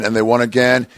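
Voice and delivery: normal talking voice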